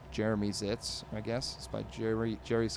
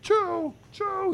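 two loud, very high "choo" sneezes; repeated mock sneezes; 8 dB louder than usual. Mooch sneezing while cats sing, high loud mock sneezes. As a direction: squeal out a pair of cartoon sneezes. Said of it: "He's sneezing again".

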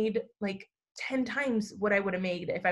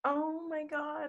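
tearful hush; 5 semitones higher, 9 dB quieter, much slower than usual. Real, not acted. Moved by book dedication.

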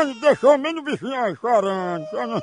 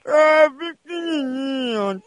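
usual speaking voice

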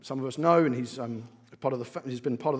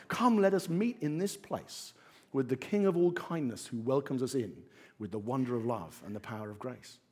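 poem recital; 3 semitones lower than usual. Acted. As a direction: recite the song words gently, like a poem. Reciting hymn lyrics Vagabonds.

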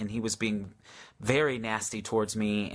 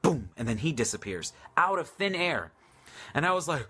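normal talking voice

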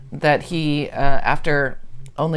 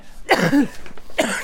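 rough cough from a cold; coughing fit; 8 semitones higher than usual. Real, not acted. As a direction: cough hard, clearing the throat. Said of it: "Getting over a cold".

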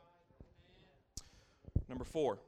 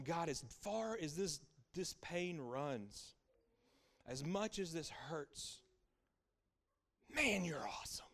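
retold tearful prayer; 4 semitones higher, slower than usual. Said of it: "It came out in tears".

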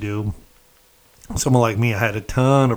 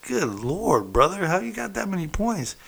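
amazed disbelief; 10 semitones higher than usual. Retold or imitated. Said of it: "And I was like".

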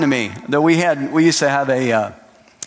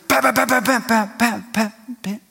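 hail noise; 8 semitones higher than usual. Retold or imitated. Hail stopping sound.

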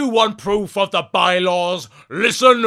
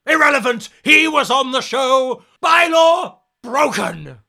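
high, loud furious official; angry shout; 8 semitones higher, 6 dB louder than usual. Acted. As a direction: shout the rule like a furious judge.

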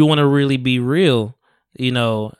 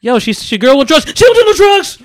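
high, loud pleading rant; imitating Lucas; 13 semitones higher, 5 dB louder than usual. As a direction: plead hard with a friend. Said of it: "He was trying to break shit down to Jason".

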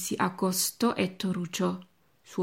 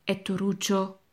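plain reading voice

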